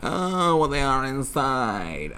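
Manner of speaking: high, slow mock-sappy coo; mocking echo. Host parroting the woman's sentiment, mocking and drawn out. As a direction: draw it out high, mimicking her.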